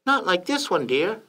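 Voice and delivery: high falsetto wife; wife's voice. A narrator's reading. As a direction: coax your husband in a light falsetto. Wife answers in story.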